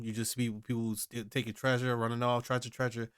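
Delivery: normal talking voice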